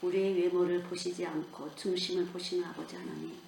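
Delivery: soft, hushed prayer voice; closing prayer. Acted. Preacher prays softly over the congregation in a hushed prayer voice. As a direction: pray it low and tender.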